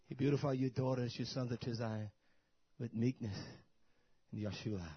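ordinary delivery

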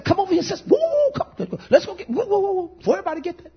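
high, teasing sing-song whoops; playful whooping call. Acted. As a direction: call her over like a party host. Preacher playfully calls a sister over.

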